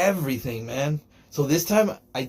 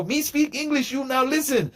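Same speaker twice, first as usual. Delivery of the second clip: higher, halting broken-English voice; mock caveman English, pitched up; 11 semitones higher than usual. Mocking doubters with pitched-up broken English.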